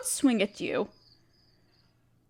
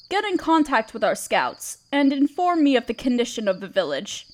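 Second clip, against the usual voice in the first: ordinary talk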